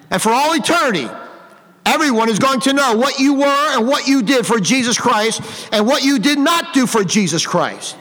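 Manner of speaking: ordinary talk, nothing marked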